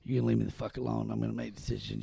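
low, quiet gruff boss voice; a hint of Belichick brushing off the owner; imitating Belichick. Voicing Belichick telling off Jerry Jones.

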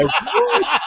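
high, laughing disbelief; a laughing, squeaky "what?"; surprised. Retold or imitated. Reacting to the fake news.

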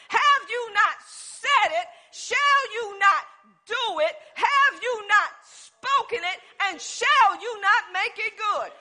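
full-force sermon questions; preaching. Acted. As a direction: build the challenge to God deliberately.

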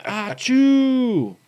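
loud drawn-out mock achoo, high pitched; sneezing. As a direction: act out a big slow sneeze. Mimicking his loud sneeze.

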